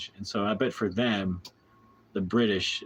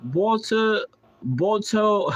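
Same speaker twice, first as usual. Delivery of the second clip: loud, high British "Boto"; hammed-up British accent, pitched way up; mock British pronunciation; 12 semitones higher, 6 dB louder, much slower than usual. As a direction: hoot it in exaggerated British. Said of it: "how to pronounce British words".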